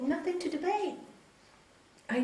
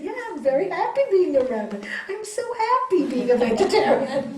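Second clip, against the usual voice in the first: louder, laughing through it; cheerfully owning it, laughing; gleeful and giggling; laughing retort; 4 semitones higher, 5 dB louder than usual. Retold or imitated.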